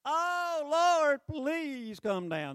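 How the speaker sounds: high, loud, slow theatrical plea; hammed-up begging to the Lord; mocking a showy prayer Elijah doesn't make; mock pleading prayer. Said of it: "He doesn't do the".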